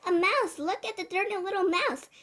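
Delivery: high, loud cry of alarm; yelling. A narrator's reading. Man spots a mouse in his kitchen, yelling. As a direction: yell it out as a startled man. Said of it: "I heard a man's voice yelling".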